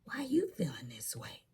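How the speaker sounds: hushed fake concern; nosy complainer prying, whispered. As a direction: lean in and whisper. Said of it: "they wanna get all the".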